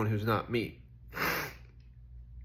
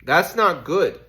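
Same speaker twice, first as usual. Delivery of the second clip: mental block voice; 6 semitones higher, 9 dB louder, slower than usual. Retold or imitated. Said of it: "my mental blocks would be like".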